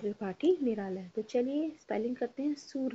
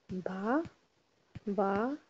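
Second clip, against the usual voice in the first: usual speaking voice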